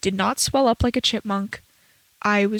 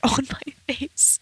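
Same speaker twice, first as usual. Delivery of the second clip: whisper; 4 semitones higher, 4 dB quieter, slower than usual. Real, not acted. Whispered laughing aside.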